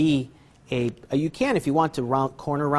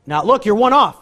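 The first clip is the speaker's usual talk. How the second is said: raised, higher emphatic call; loud alert; 7 semitones higher, 13 dB louder than usual. Shown as a demonstration. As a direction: call it out loudly like a sudden snag. Sudden alert about missed force.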